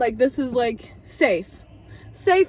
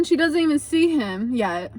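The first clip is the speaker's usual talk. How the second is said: ordinary delivery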